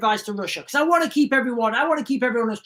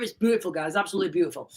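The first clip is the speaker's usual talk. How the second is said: choked up; 5 semitones lower, 5 dB quieter, much faster than usual. Real, not acted. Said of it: "I am choking up".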